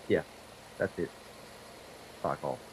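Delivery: usual speaking voice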